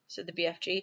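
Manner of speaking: ordinary delivery, nothing marked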